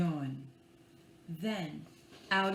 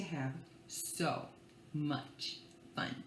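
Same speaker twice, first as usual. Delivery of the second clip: whisper; 7 semitones lower, 10 dB quieter, slower than usual. Acted. Gentle ending line.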